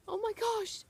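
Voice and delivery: high excited gasp; starstruck gasp. Retold or imitated. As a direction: squeal it like a thrilled fan. Fan reacting, starstruck.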